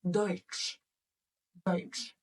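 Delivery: Deutsch with oi and tsch ending, said twice; German Deutsch. Shown as a demonstration. Teacher reminding class of the word for German, model repetition. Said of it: "try to remember how do we say German".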